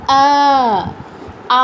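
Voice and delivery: আ held long and level; long vowel. Shown as a demonstration. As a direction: stretch each আ to two beats. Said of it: "একটু বেশি time নিতে হবে". (টেনে লম্বা আ)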